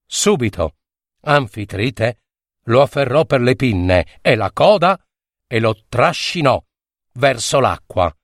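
ordinary delivery, nothing marked

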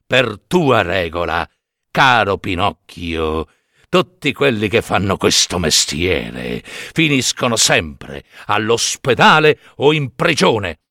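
put-on a wise old cricket warning gravely; stern cricket. Cricket low, slow.

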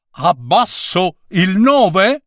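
"Abbasso il nove" ripetuto molto più alto, in tono offeso, con la voce che sale sulla fine; il nove ripete offeso. A narrator's reading. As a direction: citare le parole dello scolaro con stizza, salendo su "nove". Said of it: "perché hai gridato". (il nove ripete la frase dello scolaro, acuto e indignato)

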